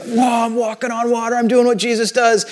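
amazed "whoa", pitch climbing; imagined excitement. Retold or imitated. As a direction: imitate Peter marveling at himself. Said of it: "You can imagine the excitement".

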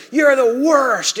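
drawn-out loud, high mock yell; yelling friend. Furious surfer cussing out his friend, retold loud yell. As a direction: imitate an angry yell, slow and loud. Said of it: "starts yelling at me".

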